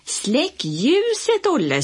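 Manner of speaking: slow, high, stretched-out yell; boy bossing his friend from across the yard; shouted order. A narrator's reading. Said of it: "skrek Lasse".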